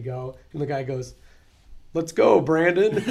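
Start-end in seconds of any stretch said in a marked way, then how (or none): 1.9-3.0 loud, higher, drawn-out chant; imitated cheer. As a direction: belt it out slowly to the cabin. Guy cheering on the plane.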